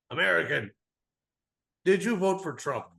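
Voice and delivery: imitating a pub heckler; jeering pub local. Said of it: "one of 'em says".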